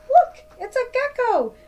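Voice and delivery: put-on kid-thrilling reveal of the hidden gecko; excited discovery. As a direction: point and squeal it out.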